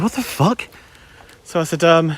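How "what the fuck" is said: incredulous thought. Retold or imitated. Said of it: "I'm thinking".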